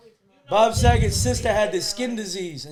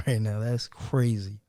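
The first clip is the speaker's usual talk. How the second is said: somber low voice; 9 semitones lower than usual. Real, not acted. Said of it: "the sexy voice again".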